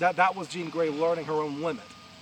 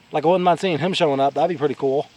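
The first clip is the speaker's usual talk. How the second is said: raised voice over machinery noise; loud; 12 dB louder, faster than usual. Real, not acted. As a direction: talk loud over the background.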